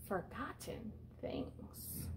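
slow, hushed whisper; breathy slow storyteller whisper; storybook whisper. Acted. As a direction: drop to a secret whisper for the mystery. Hushed mystery while reading story.

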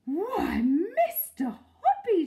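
loud, high, drawn-out exclamation; astonished cry. A narrator's reading. Mrs Silver hearing the proposal, crying out. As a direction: cry out in delighted surprise. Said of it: "she cried".

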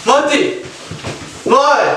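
high urgent call of his name; worried call. Real, not acted. Brother not answering, urgent worried call. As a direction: call out to him in worry.

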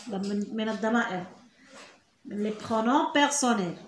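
normal talking voice

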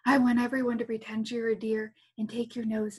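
normal talking voice

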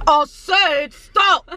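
slow, put-on man voice. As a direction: say it like a gruff man. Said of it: "a man voice".

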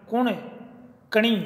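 ordinary talk

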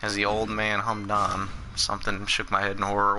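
mock a narrator with no energy; low-energy narrator. Gamer mimics the game's narrator, lightly and without energy. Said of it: "he had no em-, energy going on".

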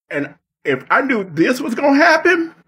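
a high wobbly fake sob through the words; mock crying. Streamer mourning his disrespectful elimination, mock crying. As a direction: pretend to break down in tears, hammed up.

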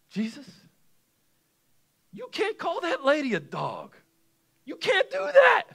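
high-pitched shocked protest; hammed-up scandalized protest, very high; mock outrage. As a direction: object in disbelief, climbing to a squeaky shout. Mock protest at Jesus's words.

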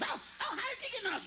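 high, fast mock alarm; lightly voiced flustered wife; mock startled wife. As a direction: play the surprised wife. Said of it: "She goes".

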